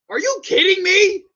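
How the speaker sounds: high, loud, incredulous shout; outraged disbelief. Real, not acted. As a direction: shout it at the screen.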